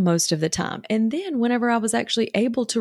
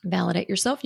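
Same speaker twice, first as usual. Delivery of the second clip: ordinary delivery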